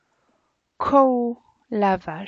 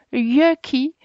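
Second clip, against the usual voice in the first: "Yucky" said louder and higher, in mock disgust; 4 semitones higher, 7 dB louder than usual. Shown as a demonstration. Teacher acting out a child's disgust for pupils: "Yucky" said louder and higher. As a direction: pull a face and spit out "Yucky" like a child tasting soup. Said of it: "c'est une façon de dire burk".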